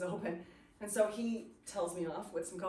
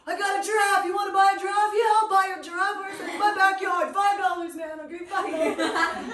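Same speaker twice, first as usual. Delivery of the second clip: much higher, loud, hammed-up drunk hawking a giraffe; drunk man; 11 semitones higher, 12 dB louder than usual. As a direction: ham up a rowdy drunk yelling down the subway car. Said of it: "he's, like, talking to the guy down the other end".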